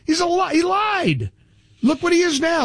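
sudden outraged burst; outraged shout. Real, not acted. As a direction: shout that he lied. Outraged accusation.

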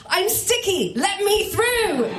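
high, slow yell to clear a path; mock scream through the crowd. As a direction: shout it high to part the crowd. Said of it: "scream at the crowds".

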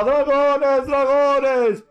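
high, loud cry of alarm; panicked crowd shout. Acted. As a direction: scream it like a terrified townsperson. Frightened crowd shouts dragons.

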